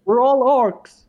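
loud, high-pitched rallying cry; high, loud in-character appeal; calling out. Acted. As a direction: shout it out to the whole group. Calls out appeal to the orcs.

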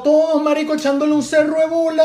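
high-pitched, loud outburst; heated protest. Real, not acted. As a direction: cut in loudly, pitch shooting up. Heated argument about how [name] started.

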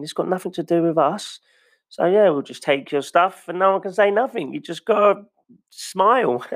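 mock cheerful gloating; smug mockery. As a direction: gloat at the people who lost everything. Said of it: "and it's like".

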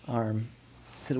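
ordinary delivery, nothing marked

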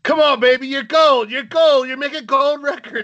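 high, loud cheerleading; hyped encouragement. Pretend. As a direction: egg them on like a hype man. Hyping up the tired performer.

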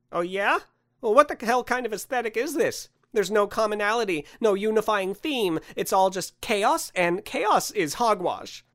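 high, indignant rant; frustrated lashing out. Acted. Man lashes out at his benefactor Santa, frustrated. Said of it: "so, frustrated, he lashed out".

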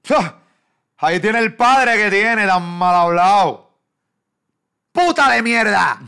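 high, loud, slow insulting jeer; hammed-up hater; mock troll rant. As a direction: sneer like a nasty commenter. Voicing hateful commenters.

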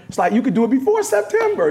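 ordinary talk

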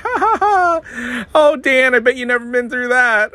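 hammed-up cheerful gloating; laughing Midwest friends. Dan mimicking friends laughing after the spin-out, high mocking laugh. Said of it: "They're like".

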